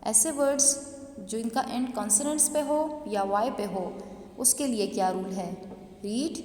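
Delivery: ordinary talk, nothing marked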